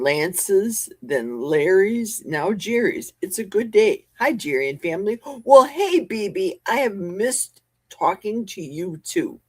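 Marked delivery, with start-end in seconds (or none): none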